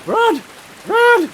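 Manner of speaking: high, loud panicked cries; panicked shout. Real, not acted. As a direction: yell at yourself to run. Fleeing in game.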